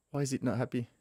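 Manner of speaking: usual speaking voice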